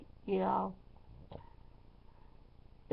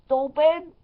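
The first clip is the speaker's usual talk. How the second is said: louder, higher scolding; exasperated retort; 6 semitones higher, 11 dB louder than usual. Retold or imitated. DJ scolding guests by the speakers, loud and higher. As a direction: scold them for sitting by the speakers.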